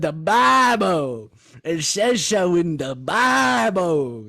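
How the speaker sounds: put-on loud, high, very drawn-out Southern accent; mock Southern accent. Host gleefully imitating the prank guest, booming Southern accent. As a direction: bellow it in an exaggerated Southern accent. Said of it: "I like that accent".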